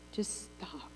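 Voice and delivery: very soft, hushed command; barely voiced just stop. Shown as a demonstration. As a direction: hush them into stopping. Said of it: "Like right now".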